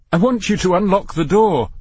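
high, tightly held irritation; strained self-control. A narrator's reading. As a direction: struggle to keep your voice even. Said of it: "He struggled to keep his voice even".

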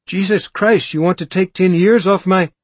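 high, loud startled outburst; startled exclamation. A narrator's reading. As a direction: blurt it out, caught by surprise. Startled man blurts in shock.

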